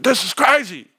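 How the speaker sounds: high, excited outburst; retold amazement. Streamer reliving his first big wage offer, amazed. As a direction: blurt it out high, astonished. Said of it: "I was like".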